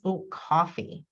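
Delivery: slow, clear "Oh, coffee" said like a button label; slow modeling. Shown as a demonstration. Therapist models a drink choice, slow and deliberate. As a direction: spell the word out slowly for the learner. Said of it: "that page would change".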